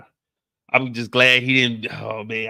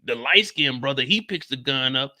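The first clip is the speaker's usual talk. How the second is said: ordinary talk, nothing marked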